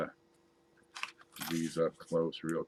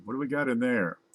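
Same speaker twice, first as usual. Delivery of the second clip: ordinary delivery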